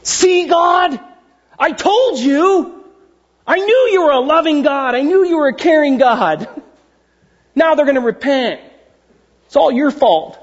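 high-pitched whiny tantrum at God; hissy fit. Acted. Jonah complaining to God about his mercy, whiny and high. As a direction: throw a petulant, high tantrum. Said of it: "Jonah throws a hissy fit".

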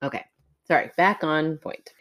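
ordinary delivery

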